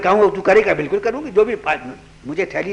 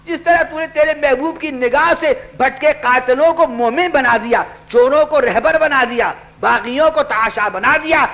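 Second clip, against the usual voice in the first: fervent supplication; 5 semitones higher than usual. Acted. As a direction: beg God on her behalf. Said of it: "آپ نے دعا کی".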